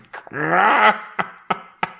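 high, loud cackling laugh; a bird's mocking cackle; raven cackle. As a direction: raven laughs at the fox's botched figure. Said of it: "lachte Herr Schwarzrock".

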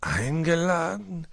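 slow, drawn-out rising question; puzzled musing. A narrator's reading. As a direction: wonder aloud, stretching the word. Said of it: "grübelte Herr Fuchs laut vor sich hin".

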